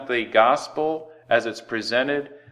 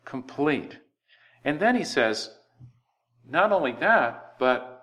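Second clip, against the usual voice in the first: usual speaking voice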